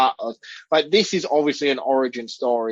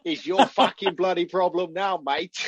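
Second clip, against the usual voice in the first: higher-pitched, hammed-up British accent; exaggerated British accent; 6 semitones higher than usual. Shown as a demonstration. Performing the line again on the host's request, exaggerated British accent. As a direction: lay the British accent on thick. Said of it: "do your British accent one more time".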